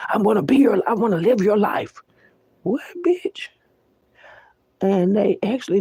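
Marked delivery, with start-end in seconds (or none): 0.1-2.0 taunting imitation of a life thief; mock envious voice. Mocking killers wanting victims' lives. 2.6-3.5 squeaked high, sharp disbelief; high startled "what, bitch"; incredulous retort. Real, not acted. Indignant retort to imitated killer.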